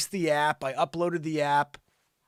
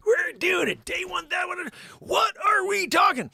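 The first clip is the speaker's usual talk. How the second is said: high, loud hype shout; excited shout; 4 dB louder than usual. Real, not acted. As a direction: pump up the crowd. Hyping up the live stream.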